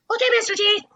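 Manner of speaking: put-on high, meek child's reply; sweet, compliant kid voice; little kid voice. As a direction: squeak it like an obedient five-year-old. Five-year-old answers teacher.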